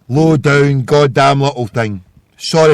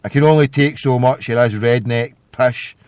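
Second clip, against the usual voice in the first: ordinary talk, nothing marked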